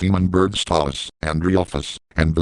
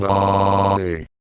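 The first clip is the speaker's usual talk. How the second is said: drawn-out fake moan; hammy held moan; mock moan.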